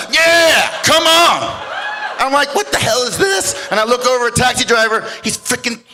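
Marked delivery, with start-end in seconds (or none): 0.1-1.6 high, loud acted fighting yell; shouted challenge. Opponent pulls off his belt to fight, shouted challenge. 2.5-3.7 high-pitched, baffled exclamation; incredulous outcry. Retold or imitated. Storyteller reacting to men pulling off belts, incredulous outcry. His startled reaction to belt fight.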